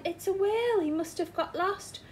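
excited. Acted. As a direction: rush it out in alarm. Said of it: "exclaimed Mister Grinling".

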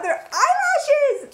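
real high, loud, drawn-out squeal of delight; thrilled squeal at a surprise find; excited squeal. Real, not acted. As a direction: shriek it out, stretched and very high. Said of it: "I'm really, really excited".

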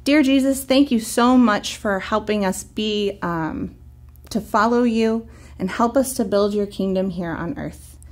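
ordinary delivery

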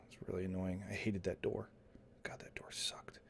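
very low, hushed grumble trailing into whisper; grumbling aside. Acted. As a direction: grumble under your breath, fading to a whisper. Grumbling aside about the door.

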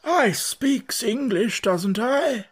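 slow, high drawling frog; slow old frog. A narrator's reading. Old frog grumbling at Alice, slow and drawling. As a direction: drag each word like a dull old frog.

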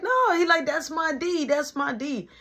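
high, insistent imitation of the other player; pushy dude voice. Teller mimicking the pushy player claiming his defender, high and insistent.

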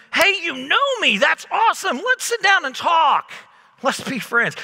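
overjoyed, loud and high; hammed-up happy greeting; gushing fake enthusiasm; mock delight. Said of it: "my first reaction wasn't".

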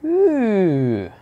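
high, loud squeal of delight; excited ooh. Real, not acted. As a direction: squeal at the new spell. Spots spell to learn.

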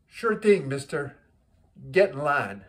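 put-on loud, punchy Italian-accented reply; Italian man. Italian man answering the businessman's request, emphatic Italian accent. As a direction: deliver the punchline as an Italian widower. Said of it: "the Italian man".

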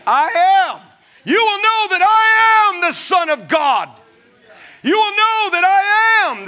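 high, loud proclaiming of "I am"; preaching shout. Acted. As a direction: thunder it out from the pulpit.

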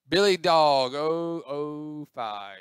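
loud, slow winner announcement; arena-style reveal of the winner; mock announcer. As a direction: draw out the name and number like an announcer.

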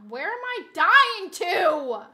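loud, drawn-out high yell; exasperated yell. Real, not acted. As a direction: shout it slowly in exasperation. Yells at deaths in game.

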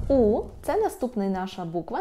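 ordinary talk, nothing marked

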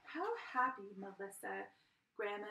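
ordinary delivery

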